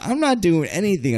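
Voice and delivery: high-pitched retold protest; defensive. Guy accused by the girl's sister, defensive.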